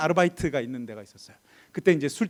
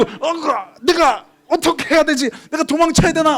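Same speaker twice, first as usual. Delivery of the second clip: high, agitated self-questioning; raised voice, frantic and at a loss; flustered panic; 8 semitones higher, 5 dB louder, slower than usual. Retold or imitated. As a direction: stammer in acted alarm. Son panicking when discovered.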